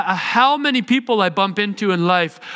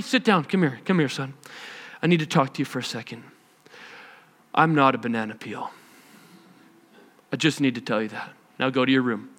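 much lower, much softer patient father voice; mock calm dad; 8 semitones lower, 7 dB quieter, faster than usual. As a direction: talk him down gently and quietly, a touch overdone. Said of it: "So I don't usually say".

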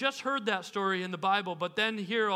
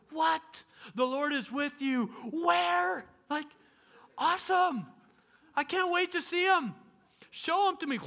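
an eager, thrilled reply; eager excitement; 7 semitones higher than usual. Pretend. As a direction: sound like a kid promised a treat.

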